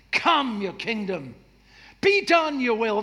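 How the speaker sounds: loud, high, forceful command; commanding declaration. Shown as a demonstration. Preacher shows how to pray, commanding and loud. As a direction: put your foot down and declare it. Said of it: "That's the way it's written".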